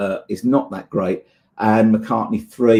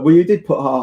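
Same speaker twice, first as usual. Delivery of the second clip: ordinary talk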